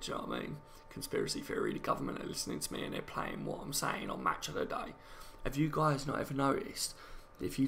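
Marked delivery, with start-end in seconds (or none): 0.0-4.9 low hushed whisper; hushed conspiracy voice. Pretend. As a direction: whisper it like a secret. Jokey conspiracy theory aside.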